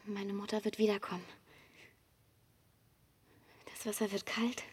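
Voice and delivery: calm, even first-person narration over music; Ida's inner voice, close and steady; inner monologue. A narrator's reading.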